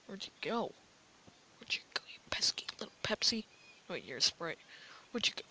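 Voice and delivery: fast unvoiced whisper while hunting; sneaky whisper. Real, not acted. Hunting a hidden player.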